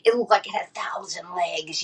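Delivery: hushed, spooky whisper; creepy whisper. Real, not acted. Describing the thousand-legged creature, in a spooky whisper. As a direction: breathe it out like a ghost story.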